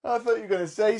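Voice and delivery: usual speaking voice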